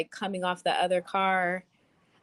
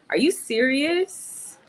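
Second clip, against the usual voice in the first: surprised; 9 semitones higher, 3 dB louder, slower than usual. Real, not acted. Reacting to free bins comment.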